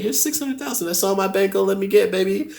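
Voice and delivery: high, coaxing mock-sweet voice; butter up the robber with a high sweet voice; sweet-talking robbers.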